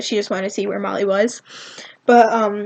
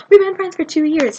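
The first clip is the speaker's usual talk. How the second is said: higher, louder call-out; retold shout; 6 semitones higher, 5 dB louder than usual. Becky shouts to Colleen from the crowd, retold shout. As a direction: imitate a friend shouting from the seats. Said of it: "I think, shouted".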